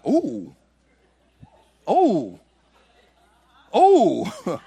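slow, drawn-out ohs of amazement; be struck by something holy; stunned wonder. Retold or imitated. Boy surprised as the Spirit hits him. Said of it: "I was like".

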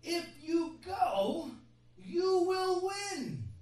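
slow, high and loud promise; emphatic prophecy. Acted. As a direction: drive it home slowly.

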